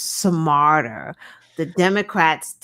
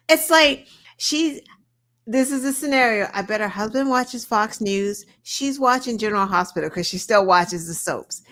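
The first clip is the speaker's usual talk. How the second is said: ordinary delivery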